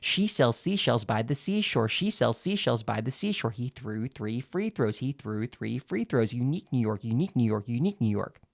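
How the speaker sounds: crisp, clearly articulated tongue twisters, run on smoothly; clear enunciation. Shown as a demonstration. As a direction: enunciate each word clearly without a break. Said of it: "you'll sound much more clear".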